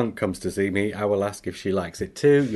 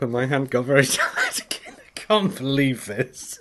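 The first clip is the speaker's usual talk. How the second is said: laughing through words; cracking up; 3 semitones higher than usual. Real, not acted. Cracking up reading.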